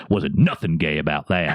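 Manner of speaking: drawn-out, low retold defiance; squaring up. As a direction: imitate a drunk squaring up to someone. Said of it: "bows up to him and goes".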